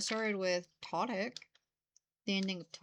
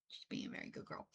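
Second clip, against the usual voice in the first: whispering; 10 dB quieter, faster than usual. Real, not acted. Aside praising the dog.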